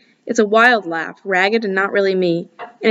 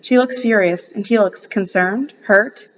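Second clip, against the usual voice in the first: usual speaking voice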